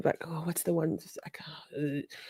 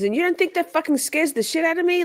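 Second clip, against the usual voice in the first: much higher mock question; pitched-up indignant Katie; 12 semitones higher than usual. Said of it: "And she says".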